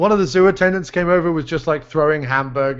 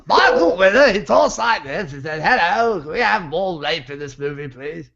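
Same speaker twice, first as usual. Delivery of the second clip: Michael Winner. Acted. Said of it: "do my Michael Winner impersonation".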